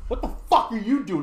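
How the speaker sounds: loud, high-pitched challenge; retold confrontation. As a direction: imitate a buddy confronting kids. Buddy confronts the teenagers, retold.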